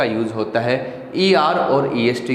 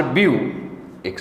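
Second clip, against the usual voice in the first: View, टी, फुल as separate sounds with pauses; syllable by syllable. Shown as a demonstration. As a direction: count out view... टी... फुल one at a time. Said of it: "तो एक sound बनती". (ब्यू, टी, फुल अलग-अलग, रुक-रुककर)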